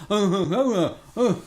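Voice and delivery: mock ventriloquist grunting; hammed-up strangled oh sounds; straining to keep lips still. Shown as a demonstration. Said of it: "you go".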